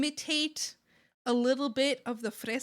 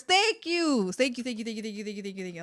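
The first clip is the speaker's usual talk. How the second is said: repeated thanks, fading; faster than usual. Real, not acted. Rattled-off thanks for a save.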